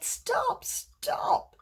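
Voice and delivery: very high, strained stop, stop; desperate pleading cry. Acted. Cup begs painter to stop.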